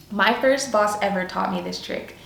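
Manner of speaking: usual speaking voice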